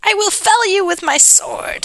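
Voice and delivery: high-pitched pretend threat; playful storybook threat; mock heroic character voice. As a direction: declare it in a hammed-up high voice. Joking off-script line in character.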